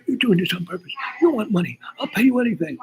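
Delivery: high, pleading imitation of the boss; mock desperate plea. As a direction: beg with rising panic. Client accusing then pleading.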